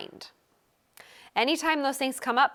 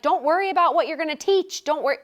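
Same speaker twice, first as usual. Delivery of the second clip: reassuring urging; 7 semitones higher, 5 dB louder, faster than usual. Retold or imitated. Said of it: "that's why I'm like".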